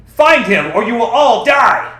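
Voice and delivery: very high, very loud commanding shout; furious shout. Acted. Commander ordering villagers to hunt the boy, shouting. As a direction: roar the order at the crowd. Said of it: "Darstad shouted at the villagers".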